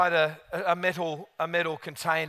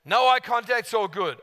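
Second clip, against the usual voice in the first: ordinary talk, nothing marked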